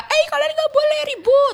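high-pitched mock telling-off; imitated scolding. Class president tells off noisy classmates, high mock scolding. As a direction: call it out like an officious class monitor. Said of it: "sering ditegur aja".

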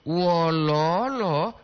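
slow, drawn-out "wololo"; "wololo" syllable by syllable, lengthened; deciding intonation. Shown as a demonstration. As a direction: stretch the vowels of "wololo". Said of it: "intonasi itu juga menentukan".

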